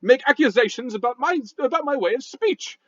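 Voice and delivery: huffy posh British rock voice; indignant British gentleman scolding; a rock with a stuffy British accent; angry British. Acted. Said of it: "angry British sounding talk".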